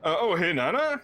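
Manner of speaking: proud homecoming Siddiqui. Acted. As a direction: announce your arrival home like a proud son. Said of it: "he turns and he says".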